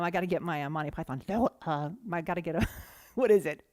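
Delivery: ordinary talk, nothing marked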